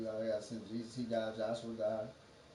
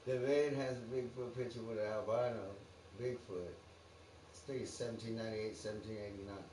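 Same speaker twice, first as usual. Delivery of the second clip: usual speaking voice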